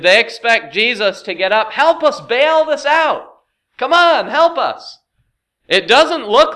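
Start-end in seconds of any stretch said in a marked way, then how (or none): 1.7-5.0 high, loud, drawn-out plea; acted shouting to rouse a helper; urgent call for help. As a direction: yell for a hand, pitched well up. Acting disciples calling Jesus for help.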